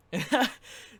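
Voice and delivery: short burst of laughter. Real, not acted. Storyteller amused by his accent attempt, laughing. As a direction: laugh at your own bad impression.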